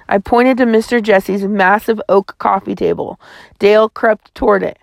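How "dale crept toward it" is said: usual speaking voice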